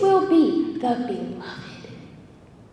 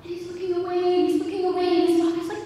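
ordinary talk, nothing marked

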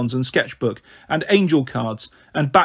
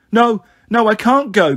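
higher and louder, a panicked no; a hurt girl begging not to go; frightened protest; 6 semitones higher, 5 dB louder than usual. Acted. Injured girl refusing hospital.

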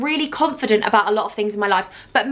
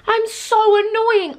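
whiny self-mockery; 10 semitones higher, 3 dB louder, slower than usual. Real, not acted. Self-mocking over near tears.

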